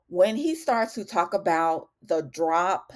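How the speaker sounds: usual speaking voice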